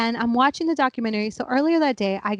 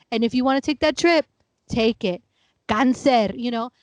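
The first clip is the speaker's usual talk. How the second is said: ordinary talk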